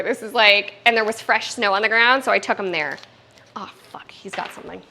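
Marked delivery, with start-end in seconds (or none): 3.5-4.8 hushed alarm. Real, not acted. Puppy grabs something.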